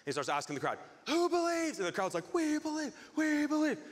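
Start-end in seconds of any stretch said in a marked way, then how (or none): 1.1-1.8 high showman's call to a crowd. Retold or imitated. Tightrope walker asking the crowd, calling out. 2.3-3.8 high, drawn cheer of a crowd; imitated crowd screaming. Crowd screaming for this guy, mock screaming. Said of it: "they're screaming for this guy".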